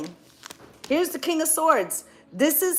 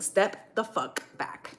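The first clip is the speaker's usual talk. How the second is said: softer, slow, measured order; Spirit's firm command; 5 semitones lower, 8 dB quieter, slower than usual. Pretend. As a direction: lay it down slowly and quietly, like a final word. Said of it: "that's why Spirit's saying".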